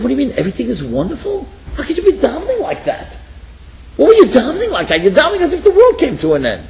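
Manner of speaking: loud incredulous pressing of the weeping man; incredulous exclaim. Retold or imitated. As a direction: demand to know what's really wrong.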